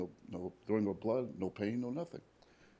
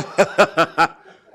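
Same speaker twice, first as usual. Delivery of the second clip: loud real burst of laughter; hearty laugh; 8 semitones higher, 18 dB louder than usual. Real, not acted. Joking about his travels, hearty laugh. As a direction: crack up laughing.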